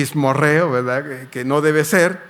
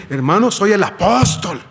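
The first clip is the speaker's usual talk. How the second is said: raised, louder mock-pompous declaration; mock self-important announcement; 4 semitones higher, 4 dB louder than usual. Preacher mimicking a brother who flaunts his title, mock self-important. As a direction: proclaim the title like someone showing off.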